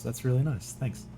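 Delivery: ordinary delivery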